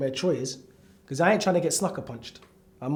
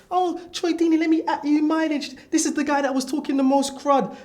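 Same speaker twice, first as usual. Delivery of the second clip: mock snitch voice; 7 semitones higher than usual. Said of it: "Poet, you're a snake".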